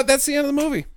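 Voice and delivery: normal talking voice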